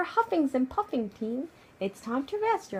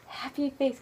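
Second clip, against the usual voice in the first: usual speaking voice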